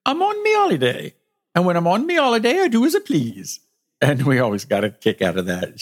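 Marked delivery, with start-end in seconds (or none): 0.0-3.6 high-pitched put-on British lady's accent; mock British accent, pitched way up. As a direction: imitate a British woman insisting, high and lilting. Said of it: "said in her beautiful British accent".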